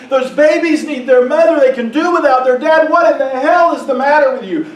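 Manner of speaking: loud, anguished confrontation; raging at God. Retold or imitated. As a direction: chew God out, demanding answers. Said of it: "call him on the carpet".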